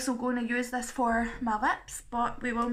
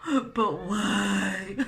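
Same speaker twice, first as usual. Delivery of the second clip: gasp, then a drawn-out fake-crying whine; mock wailing; 2 semitones lower, 4 dB louder than usual. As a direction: wail it like a hammed-up sob. Mock-crying protest at lockdown rules.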